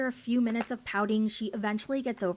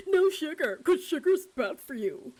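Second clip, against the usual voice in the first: preachy Kyle; 6 semitones higher than usual. Retold or imitated. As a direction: lecture her about sugar. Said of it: "and he's like".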